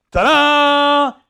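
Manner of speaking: slow, loud, drawn-out ta-da; mock fanfare. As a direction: announce it like a grand showman. Mocking a grand triumphant entry.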